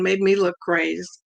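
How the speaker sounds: ordinary delivery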